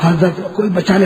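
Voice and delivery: ordinary delivery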